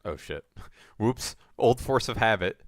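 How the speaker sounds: ordinary talk, nothing marked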